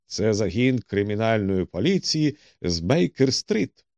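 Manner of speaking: plain reading voice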